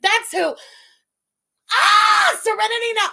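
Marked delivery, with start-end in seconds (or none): 1.7-3.1 frustrated shout. Real, not acted. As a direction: yell it high and loud in exasperation. Frustrated outburst quoting Seinfeld.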